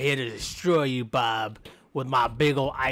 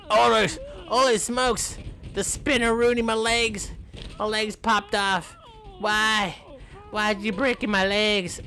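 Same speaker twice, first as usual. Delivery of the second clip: mock shock; 8 semitones higher than usual. Legs broken off in game.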